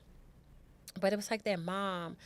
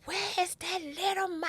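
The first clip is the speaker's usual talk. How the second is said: high shrill-free yell, pitched way up; retold screaming; 12 semitones higher than usual. Mother storming their home, screaming in fury. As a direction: scream at the boy's family in a rage. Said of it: "I just start screaming".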